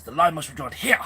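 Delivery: loud, dramatic outburst with swooping pitch; Jean-Luc Picard losing it. Retold or imitated. Fan re-enacting his favourite film outburst, losing it. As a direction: declaim it like a captain pushed to the edge. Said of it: "literally loses it".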